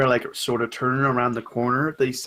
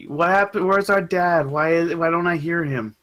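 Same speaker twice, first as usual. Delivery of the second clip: put-on higher, plaintive child character; upset child's voice, pitched up; upset kids; 5 semitones higher than usual. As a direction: demand answers like a sad, angry kid. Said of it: "like, sad and, like, looking at you angrily".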